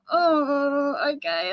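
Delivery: long-drawn, hesitant "oh", then a grudging okay; acted-out reluctant okay; reluctant agreement. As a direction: stall on the oh, then give in politely. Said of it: "I don't really wanna do it red".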